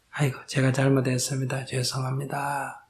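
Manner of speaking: soft, bowed-down sorry; humble apology. Shown as a demonstration. Wife apologizing meekly to her husband, soft and humble. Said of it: "이렇게 하면서".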